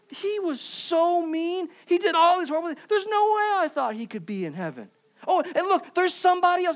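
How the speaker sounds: high, slowed disbelief at the neighbor; man in heaven pointing out his mean neighbor, high mock outrage; mock shock. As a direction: act scandalised that he made it in.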